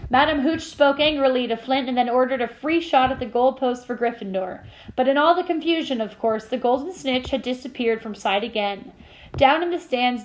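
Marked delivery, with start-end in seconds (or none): none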